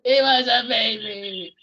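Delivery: slow hammed-up crying wail; mock sob. Host re-quotes Hawkeye's breakdown line, mock crying. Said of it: "repeating the Alan Alda line".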